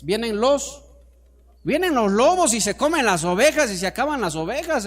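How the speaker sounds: high, loud pulpit exhortation; full-force preaching. Real, not acted. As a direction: drive each question hard at the congregation. (predicación a toda voz, aguda y enérgica)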